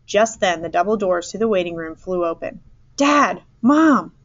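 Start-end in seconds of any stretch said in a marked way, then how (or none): 3.0-4.1 loud, high call to parents. A narrator's reading. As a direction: call out to them, pitched up. Lost son calls to parents.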